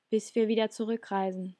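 ordinary delivery, nothing marked

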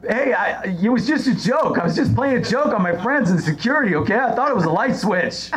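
high, pleading excuse; quoting Greg. Retold or imitated. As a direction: plead innocence like a caught kid. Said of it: "what he said was".

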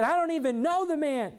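high, strained angry outburst, acted; angry denial. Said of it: "he got angry".

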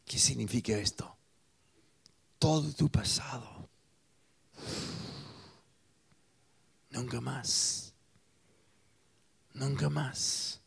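hushed sermon. Acted. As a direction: draw them in, almost in secret. Hushed intimate preaching.

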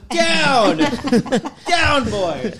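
high, loud pretend owner calling off a dog; mock dog scolding. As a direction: call off the dog in a silly high voice.

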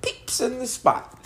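high, squeaky laughing parody; replaying the line in a silly voice; mock imitation. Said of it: "I was like".

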